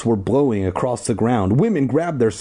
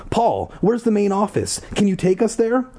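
higher, eager questions; 11 semitones higher than usual. Acted. Ashley urging Paul, eager and high. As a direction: press Paul keenly. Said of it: "She turned excitedly to Paul".

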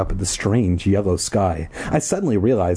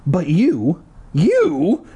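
put-on stammering high girl; 8 semitones higher, much slower than usual. A narrator's reading. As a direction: stammer it out, much higher. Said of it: "Ashley stammered".

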